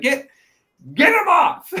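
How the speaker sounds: very high, loud outraged yell; Beerus in mock panic. Beerus wants destructive Broly gone, mock frantic yell. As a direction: yell it as a comic order.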